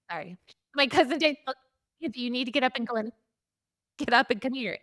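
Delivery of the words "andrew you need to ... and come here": fast, pressing, retold urgent order; hurried urgent call. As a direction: rush the words like waking someone in an emergency. Cousin hurries them to hallway.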